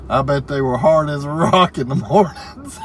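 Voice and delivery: reading through a fit of loud, high laughter; can barely get the words out for laughing; cracking up while reading the chat aloud; laughing in tears. Real, not acted. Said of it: "I'm in tears now, too".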